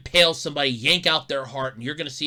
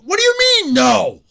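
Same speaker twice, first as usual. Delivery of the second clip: shouted disbelief, higher and louder; incredulous Banner; 10 semitones higher, 10 dB louder than usual. Retold or imitated. Banner yells back at Hulk's refusal in disbelief. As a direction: yell it in total disbelief. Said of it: "Banner's like".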